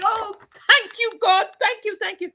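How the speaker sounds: put-on an old man's high-pitched joyful thanks; joyful screaming. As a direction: shout thanks to God for joy. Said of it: "He starts screaming".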